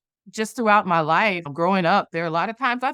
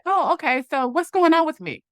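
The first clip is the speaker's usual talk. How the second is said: much higher, sweetly put-on question; polite spoken reply; 8 semitones higher than usual. Answering the young lady aloud, put-on politeness. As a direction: lift it high, hiding what you really think. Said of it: "looked at her and said".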